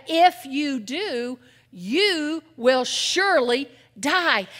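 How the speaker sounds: God's stern warning, slow and emphatic. Retold or imitated. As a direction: spell out each word slowly like a grave decree. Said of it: "God said it very emphatically".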